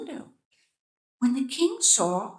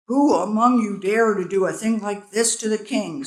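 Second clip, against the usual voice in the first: loud, pressing repeat of the demand; repeated roar; 7 dB louder than usual. A narrator's reading. King repeating his demand to insects, firm roar. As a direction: demand again, firmly. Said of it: "Solomon repeated".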